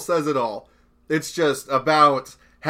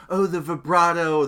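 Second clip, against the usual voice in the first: mock music reviewer; 3 semitones higher than usual. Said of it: "I tried way too hard to be like a music reviewer".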